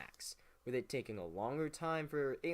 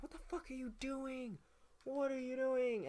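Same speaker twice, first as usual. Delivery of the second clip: much higher, incredulous mock voice; mock outraged Federalists; 10 semitones higher than usual. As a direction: squeal it like baffled party friends. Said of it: "Federalist friends went like".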